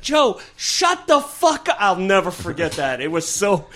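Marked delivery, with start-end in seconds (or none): 0.0-1.8 high, slow mock snarl; imitating angry Bill. Bill snapping at Joe before his set, high slow mock snarl. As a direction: bark it like a furious friend. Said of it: "He's like".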